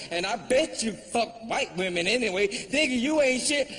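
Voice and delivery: high-pitched indignant tirade, acted out; mad woman. Audience woman tells off comedian, high and mad. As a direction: play an outraged woman telling him off, voice up high. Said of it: "She was mad as fuck".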